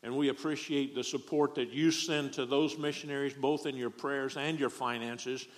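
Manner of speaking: usual speaking voice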